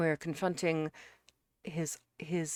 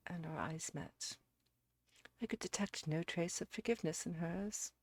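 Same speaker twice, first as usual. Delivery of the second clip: ordinary delivery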